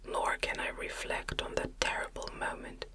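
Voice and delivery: ordinary talk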